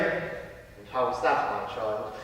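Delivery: very low, soft, slow murmur; calm priest. Acted. Priest asks how Emer married him, low and hushed. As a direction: probe gently in a confessor's hush.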